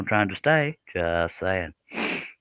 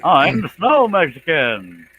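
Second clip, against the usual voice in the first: silly character voice; 6 semitones higher, 4 dB louder, slower than usual. Pretend. Goofy put-on character line.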